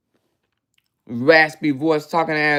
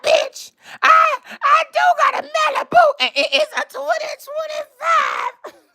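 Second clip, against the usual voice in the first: mocking raggedy voice. Mocking the rival streamer's raggedy voice, high whiny shouting. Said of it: "with her raggedy ass voice".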